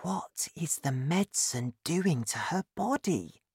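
hushed, softly wondering to himself; inner thought. A narrator's reading. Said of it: "George thought".

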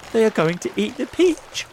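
fast, high, loud outcry; alarmed cry. A narrator's reading. Miss Spider watching sharks bite the peach, alarmed cry. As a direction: cry out in a rush of panic. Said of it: "cried Miss Spider".

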